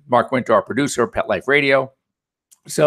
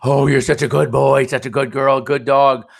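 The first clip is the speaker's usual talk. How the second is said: excited, louder gushing praise for a dog; 4 dB louder than usual. Shown as a demonstration. Vet shows owners how to praise a puppy, gushing and excited. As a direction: gush over the puppy with delight. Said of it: "couple it with".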